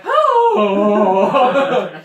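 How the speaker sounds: long, loud, high "Oh" wail; banshee scream. Shown as a demonstration. Correcting the demo of their scream, long banshee wail. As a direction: hold out a loud banshee cry. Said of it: "it was more like".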